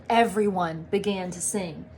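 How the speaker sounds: usual speaking voice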